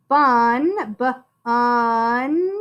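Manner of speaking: "Bun" whole, then "b-un" split into a short b and a long un; "b-un" with the first sound set apart. Shown as a demonstration. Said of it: "you can sound out".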